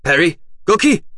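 loud, high calling of names; calling from outside. A narrator's reading. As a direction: shout for them through a door. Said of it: "eine gedämpfte Stimme". (laut rufend, wie von draußen)

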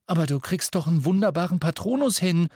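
high, fast indignant protest; indignant outburst. A narrator's reading. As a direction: protest in outrage, pitched high and quick. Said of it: "sagte Ron entrüstet".

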